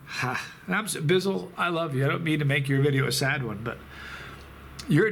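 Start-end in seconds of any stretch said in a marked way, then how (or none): none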